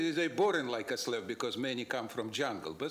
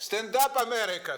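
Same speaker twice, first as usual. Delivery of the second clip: loud rallying cry, pitched high; shouting; 9 semitones higher, 7 dB louder than usual. Real, not acted. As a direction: call the crowd to rise up. Rallying call ending his comment.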